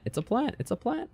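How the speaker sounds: high, rising "It's a plant". Acted. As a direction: sing it up high, questioning. Repeating the word with changing tune.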